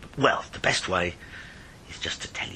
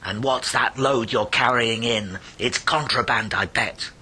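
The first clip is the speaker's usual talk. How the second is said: loud, bullying shout at the smuggler; hollering excise man; 4 semitones higher, 9 dB louder, slower than usual. Acted. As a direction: bellow it across the dark beach. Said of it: "the excise man he hollered".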